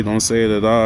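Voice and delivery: normal talking voice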